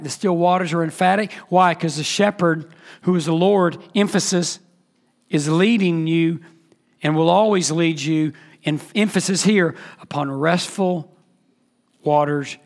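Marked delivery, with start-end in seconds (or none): none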